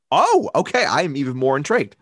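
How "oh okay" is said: high, loud surprised oh. Real, not acted. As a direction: perk up in sudden surprise. Surprised by answer.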